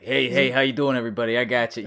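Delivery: light imitation of a thick New York accent; thicker New York accent. Imitating masked Spider-Man greeting everybody, New York accent. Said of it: "a thicker New York accent".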